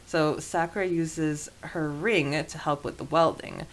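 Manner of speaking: normal talking voice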